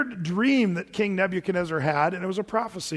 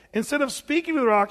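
ordinary delivery

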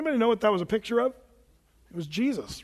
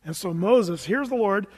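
usual speaking voice

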